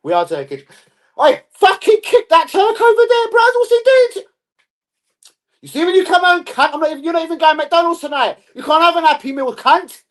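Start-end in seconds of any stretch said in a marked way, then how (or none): 1.2-5.7 very high, loud screaming; mock touchline yelling. Parent demanding a kick at the opponent, mock yelling. 5.7-10.0 high, loud shouted scolding; mock furious parent. Parent cancelling a kid's McDonald's treat, mock furious shouting.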